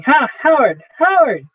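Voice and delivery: rising frightened cries of a name, pitch climbing; startled shouting. Acted. Willie shouts for Howard after the recorder startles him. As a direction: yell out in fright.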